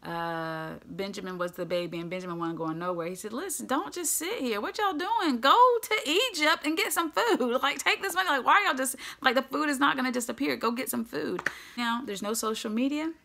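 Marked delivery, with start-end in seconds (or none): 3.3-11.4 high, loud, exasperated scolding; worked-up dad ordering his boys out; exasperated father. Acted. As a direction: nag the sons to get moving, pitched up and rising in volume. Acted father scolding his idle sons.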